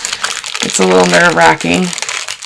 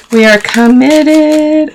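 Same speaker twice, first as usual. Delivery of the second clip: much higher and slow, stretching every word; mock-dramatic declaration; 9 semitones higher, 4 dB louder, much slower than usual. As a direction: proclaim "committed" like a grand vow, slowly.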